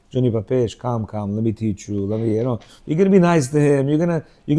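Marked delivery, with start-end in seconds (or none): 0.1-2.4 put-on soft, gentle calming of a little boy; soothing a child. Parent calming a five-year-old, soft and gentle. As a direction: ease him down softly.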